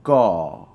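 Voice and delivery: GAW said firmly, pitch falling; a loud "Gaw" sliding downward. Shown as a demonstration. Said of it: "with a falling tone".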